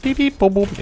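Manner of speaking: high playful censor beeps; bleeped out. Pretend. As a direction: chirp it like a bleep. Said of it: "setzen unsere Fluchwörter jetzt durch Pieplaute". (hohe verspielte Pieptöne)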